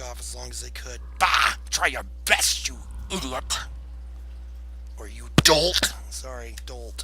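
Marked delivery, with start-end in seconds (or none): 1.2-1.5 loud explosive scoff; scornful bah. Acted. Justin scoffing at Velander's power. Defiant exclamation. 1.7-5.9 loud insulting yell; defiant shout. Acted. Justin shouting defiance at his old teacher.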